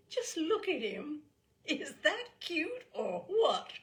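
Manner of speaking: high, cooing admirer's voice; gushing woman. Acted. Fragrant woman cooing at the small boy, high and gushing. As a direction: coo over him like a cute puppy.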